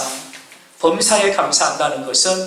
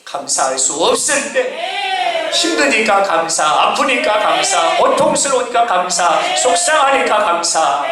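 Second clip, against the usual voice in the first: rhythmic, chant-like sermon refrain; climactic preaching; 3 semitones higher than usual. Real, not acted. Preacher sums up true thanks for congregation, climactic preaching. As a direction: drive home each phrase, stretching the key words.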